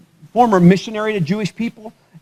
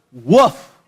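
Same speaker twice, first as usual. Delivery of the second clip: short low mock woof, laughing through it; imitated dog bark; 5 semitones lower, 4 dB louder than usual. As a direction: bark it once like a lazy dog. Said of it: "Your dog goes".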